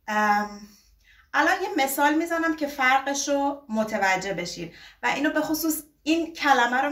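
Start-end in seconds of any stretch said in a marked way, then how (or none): none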